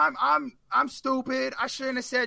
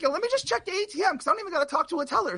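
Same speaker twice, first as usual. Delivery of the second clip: upbeat; 8 semitones higher, faster than usual. Retold or imitated. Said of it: "and I'm like".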